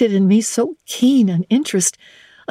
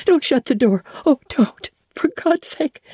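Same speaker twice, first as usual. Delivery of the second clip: rising, begging cry; the starving stranger begging the servant not to shut the door; desperate plea; 7 semitones higher than usual. Acted. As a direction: plead as the door closes.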